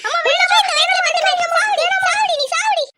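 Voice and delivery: high, loud raging cry; furious outburst. Acted. Mother rages at eloped daughter, furious outburst. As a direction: let the rage break out.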